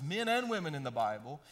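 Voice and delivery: ordinary delivery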